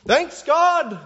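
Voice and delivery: high, loud cheer of thanks; gleeful thanks. Acted. Preacher mimics people thanking God for gifts, gleeful shout. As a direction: shout thanks like an excited kid. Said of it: "like this eight-year-old thanking his grandparents".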